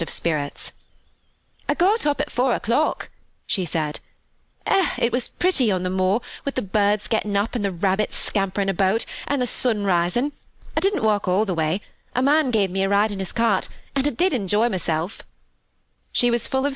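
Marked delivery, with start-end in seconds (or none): 1.7-3.1 higher, lilting Yorkshire maid; cheerful country housemaid. Acted. Maid telling Mary of her day out, cheerful. 4.7-15.2 lilting Yorkshire maid, happily recounting; cheerful country housemaid. Acted. Maid telling Mary of her day out, happy. Voices Martha in dialect.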